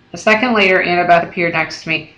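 ordinary delivery

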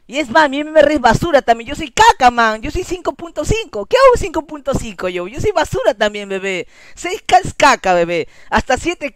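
high, loud self-mocking tirade; worked-up, insistent outburst; heated rant. Real, not acted. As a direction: rail loudly at your own rank. Ranting that he is trash too.

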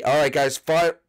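usual speaking voice

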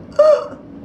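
high gasp, imitating a wheeze; imitated wheeze. Shown as a demonstration. Mother showing how wheezing sounds, imitated wheezy gasp. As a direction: mimic the catch at a breath's end. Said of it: "he'll breathe and you hear".

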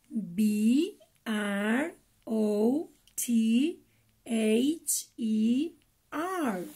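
letters one by one, slowly; slow spelling. Shown as a demonstration. Teacher spells for kids, slow and clear. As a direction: spell S I S T E R out for children. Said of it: "practiquemos un poco cómo deletrear".

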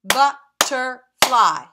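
"Butterfly" in three slow, very loud beats; "Butterfly" chopped into three punched pieces; syllable by syllable. Shown as a demonstration. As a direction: lead the class clapping each syllable of "Butterfly". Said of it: "Are you clapping with me?".

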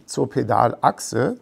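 usual speaking voice

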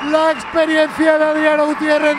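high, loud, slow triumphant commentary; excited goal call. Real, not acted. Commentator goal call after penalty.

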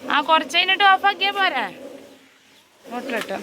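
loud high call across the house. Real, not acted. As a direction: shout it to someone far off. Calling out about the motor.

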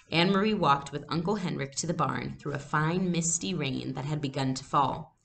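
ordinary delivery

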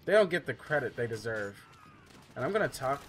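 usual speaking voice

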